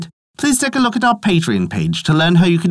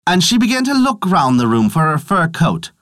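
ordinary delivery, nothing marked

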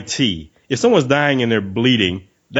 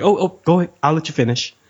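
higher, sarcastic politeness; mock-gracious, pitched up; mock polite; 6 semitones higher than usual. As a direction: sarcastically wave them on. Mock polite look at talker.

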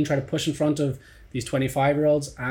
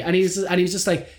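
ordinary talk, nothing marked

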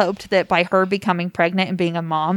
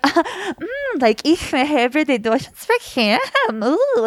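high, slow cutesy fake German; giggly bimbo-style mock German; 8 semitones higher, much slower than usual. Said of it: "I really liked your fake German".

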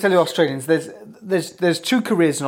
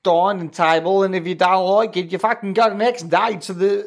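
higher, ranting Aussie bloke; Australian accent; 6 semitones higher than usual. Acted. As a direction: build into a high-pitched Australian rant. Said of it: "you love the Aussie accent".

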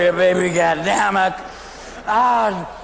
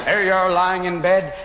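ordinary delivery

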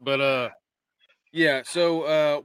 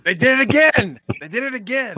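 loud, high gleeful cry; excited shout; 11 semitones higher, 11 dB louder than usual. Retold or imitated. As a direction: shout it with glee. Quoting text with glee.

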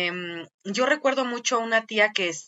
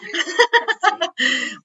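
laughing; 8 semitones higher than usual. Real, not acted. Laughs at vanilla joke.

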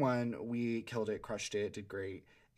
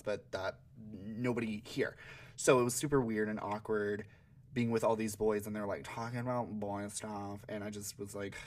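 ordinary talk, nothing marked

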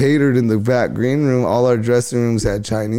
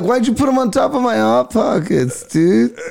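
high, put-upon complaint; high-pitched mock outrage at a friend; indignant whine; 10 semitones higher than usual. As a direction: whine about your ruined snacks. Complaining about food in freezer.

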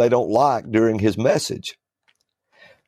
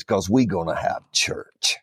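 low hushed whisper; 5 semitones lower, 3 dB quieter than usual. Retold or imitated. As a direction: drop it to a breathy threat. Quoting the preacher's final threat.

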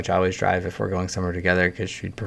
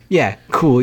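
normal talking voice

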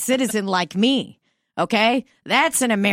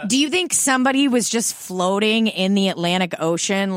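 normal talking voice

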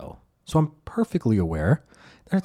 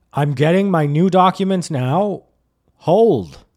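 louder, slow and formal like a report; robotic compliance; 6 semitones higher, 5 dB louder, slower than usual. Retold or imitated. Driver narrating his moves to cops, mock-formal announcing. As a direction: announce each move deliberately.

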